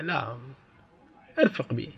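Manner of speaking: a quiet, tender plea for kindness; gentle hush. Acted. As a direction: say it softly, as if calming someone. Teacher voices the Prophet's gentle reply.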